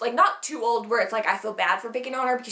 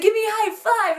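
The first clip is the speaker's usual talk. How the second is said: put-on a higher, lightly done little boy's voice; 10 semitones higher than usual. Happy little boy asking her for a high five, higher voice. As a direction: chirp it like a happy kid. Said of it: "he's like".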